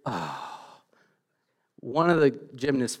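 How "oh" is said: low, soft imitated "oh" of dismay; hushed, breath-like groan from onlookers; imitated crowd groan. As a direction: imitate a crowd's quiet gasp. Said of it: "but I hear".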